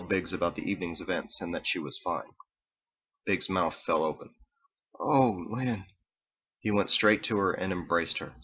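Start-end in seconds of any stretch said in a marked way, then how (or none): 5.0-5.8 rising then falling, pained exhale; dismayed sympathy. A narrator's reading. Biggs sees Lynn's scarred face, dismayed. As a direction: say her name with hushed shock and pity.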